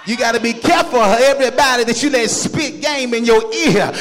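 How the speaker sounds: ordinary talk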